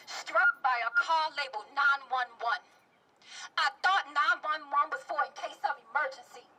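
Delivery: measured phrases building to high urgency; impassioned poetry performance; spoken-word protest poem. Acted. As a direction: recite a poem, rising into a desperate plea.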